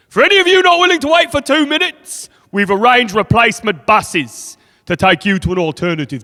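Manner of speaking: platform announcer. Acted. Train worker addressing platform passengers, booming announcement. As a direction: belt it out over the station speakers. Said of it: "they make the announcement on the platform".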